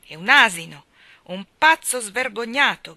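angry shout. A narrator's reading. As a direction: bellow it, losing his temper. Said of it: "gridò mister Wakem perdendo le staffe". (grido furioso, forte e lento)

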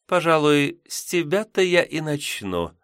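high, coy succubus voice; sly demoness. A narrator's reading. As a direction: choose your prey with relish. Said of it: "заявила она".

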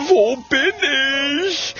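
wailing call for help; bewildered cry. A narrator's reading. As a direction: cry out, lost and alarmed. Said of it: "ruft er".

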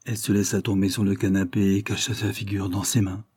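ordinary delivery

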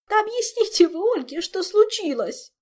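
very high, loud sobbing outburst; hysterical Zin. Acted. Zin begging others to explain to Olga, hysterical wail. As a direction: cry it out hysterically. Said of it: "истерически всхлипнула Зинь".

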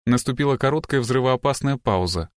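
ordinary delivery

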